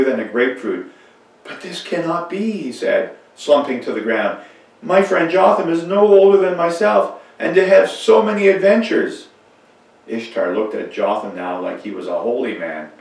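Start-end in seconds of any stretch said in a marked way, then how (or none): 1.4-9.3 high, loud amazed disbelief; astonished. A narrator's reading. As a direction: gasp it out in disbelief. Ishtar astonished at true story.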